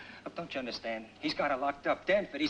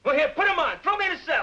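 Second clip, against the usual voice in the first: much higher, louder, daring the cops to cuff him; defiant shout; 10 semitones higher, 7 dB louder than usual. Acted. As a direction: hurl it at him: go on, lock me up. Defiant suspect dares the police.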